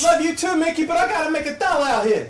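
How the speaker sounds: very high, loud crone squawk; hag voice. Acted. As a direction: squawk back like a brassy old hag.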